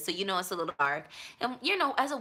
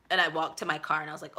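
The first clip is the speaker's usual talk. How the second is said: ordinary talk, nothing marked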